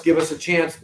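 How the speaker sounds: normal talking voice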